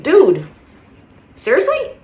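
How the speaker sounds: disbelieving dude seriously, voice shooting way up; real exasperation, pitch leaping up on seriously; incredulous. Real, not acted. Exasperated at offensive fan behavior.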